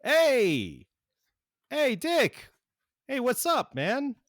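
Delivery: high, loud, friendly greeting voice; cheery Wally voice. Acted. Wally answering Robin's call, high and cheery. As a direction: greet your buddy brightly, way up high. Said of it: "you hear this voice go".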